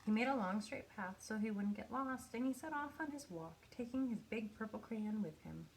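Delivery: ordinary delivery, nothing marked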